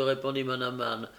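ordinary delivery, nothing marked

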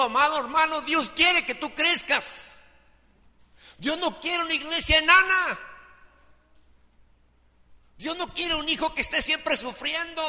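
full-force preaching that builds to a shout; fiery preaching. Real, not acted. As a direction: preach it hard to the congregation.

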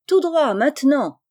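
appel gai, plus fort et plus aigu; lancez-le gaiement par-dessus l'eau; cri joyeux. Acted. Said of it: "lui cria gaiement". (cri enjoué)

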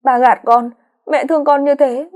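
put-on girl crying out at her grandmother, high and tearful; tearful protest. A narrator's reading. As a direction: protest through tears that it's a lie. Daughter accuses grandmother of lying.

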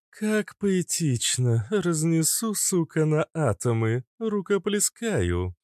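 high, drawn-out theatrical admiration; admiring mock applause. Cobra applauds the hidden gunner's threat, theatrically admiring. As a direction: savour each phrase, pitched high. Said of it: "Кобра восхитилась невидимым пулемётчиком".

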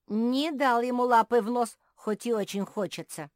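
high, loud squirrel voice; indignant appeal. A narrator's reading. Squirrel appeals to the owl, loud indignant appeal.

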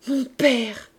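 understated exclamation, drawn from the heart; moved cry. A narrator's reading. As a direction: cry out to a father, restrained. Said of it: "s'écria Marius".

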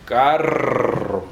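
carro with a drawn-out trill; strong rolled r. Shown as a demonstration. Teacher repeats the Spanish example, carro long trilled. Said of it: "rrr fuerte".

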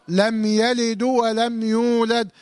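slow chanted verse, dal in "يلد" given an u vowel; wrong recitation. Shown as a demonstration. Teacher showing students a common mistake, chanting "يلد" as "yalidu". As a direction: recite "يلد" with a wrong final damma, running "du" into "ولم". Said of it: "هذا خطأ".

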